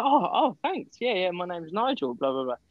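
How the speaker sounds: flattered staff member. Retold or imitated. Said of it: "And they go".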